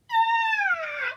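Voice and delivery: sudden high laugh; burst of laughter. Real, not acted. Laughing at the guy she is mocking.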